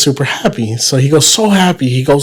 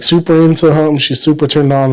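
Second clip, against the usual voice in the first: ordinary delivery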